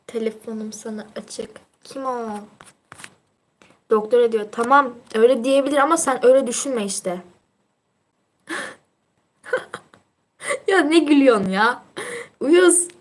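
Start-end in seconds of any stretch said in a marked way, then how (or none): none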